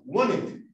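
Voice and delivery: "wanted" as "wanet", only the N; dropped T in WANTED. Shown as a demonstration. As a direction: swallow the T in "wanted". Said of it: "Se comen la T y lo pronuncian con la N nomás". ("wanted" comiéndose la T)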